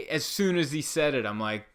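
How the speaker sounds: ordinary talk, nothing marked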